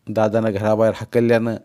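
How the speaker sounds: ordinary delivery, nothing marked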